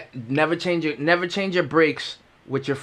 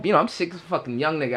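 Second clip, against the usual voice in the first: ordinary delivery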